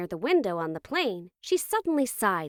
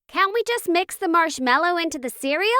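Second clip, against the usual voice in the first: put-on high, louder kid's question; pleading child; 9 semitones higher, 5 dB louder than usual. A narrator's reading. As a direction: plead upward like a hopeful child.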